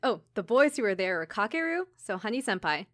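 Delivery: ordinary talk, nothing marked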